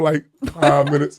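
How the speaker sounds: usual speaking voice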